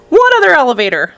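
high mock scream, falling away; alarmed scream. A narrator's reading. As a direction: shriek the question without full volume. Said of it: "screamed Missus T V".

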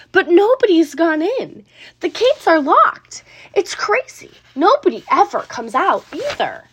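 shouting. A narrator's reading. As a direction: shout out the puzzle. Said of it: "the people shouted".

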